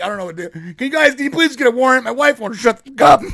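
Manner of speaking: hammed-up henpecked husband; desperate husband. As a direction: beg the agents in a rush. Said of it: "And he's like".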